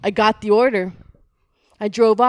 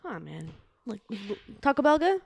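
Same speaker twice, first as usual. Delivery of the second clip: friendly cop, much softer and chummy; soft good cop; 3 semitones lower, 10 dB quieter than usual. Acted. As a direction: win him over gently like a buddy.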